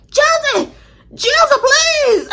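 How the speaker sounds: very high, drawn-out mock wail; hammed-up plea to a friend; dramatic begging. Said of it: "That wasn't that dramatic".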